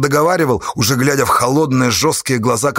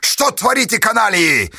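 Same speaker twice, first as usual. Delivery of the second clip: high-pitched angry demand; irate officer's yell; furious cry; 9 semitones higher than usual. A narrator's reading. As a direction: shout out in outrage, drawing out the last word. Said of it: "яростно крикнул он".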